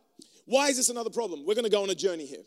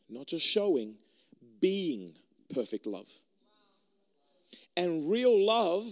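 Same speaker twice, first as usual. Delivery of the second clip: ordinary delivery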